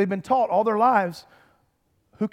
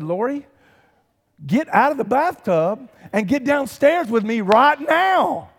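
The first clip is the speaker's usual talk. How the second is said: urgent call; 6 semitones higher, 5 dB louder, slower than usual. Retold or imitated. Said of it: "I said".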